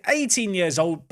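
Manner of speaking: ordinary delivery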